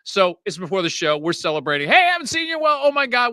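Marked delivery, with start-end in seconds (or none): 1.9-3.3 bright, high mock hello; retold excited reunion; excited greeting. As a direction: greet an old friend, pitched way up. Retold happy greeting backstage.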